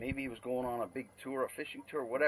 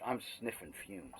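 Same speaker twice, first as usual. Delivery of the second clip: normal talking voice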